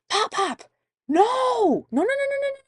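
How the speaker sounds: high, loud frantic stopping; panicked no. Retold or imitated. Mother stopping grandfather spoiling the surprise, panicking. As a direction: cut him off in a panic. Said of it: "And I was like".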